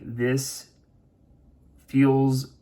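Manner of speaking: ordinary talk, nothing marked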